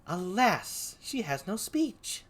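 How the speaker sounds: a soft, drawn-out lament; Desdemona. Acted. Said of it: "Here's Desdemona".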